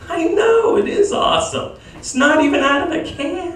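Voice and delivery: high breathless mom voice; hammed-up high mom swooning; gushing mom. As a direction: play the mom, thrilled. Mom voiced in a stand-up bit.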